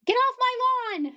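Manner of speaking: high, slow mock shout of an old grouch; hammed-up yell at kids on the lawn; playful cranky neighbour shouting; grumpy old man yell. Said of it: "I'll just yell at you guys".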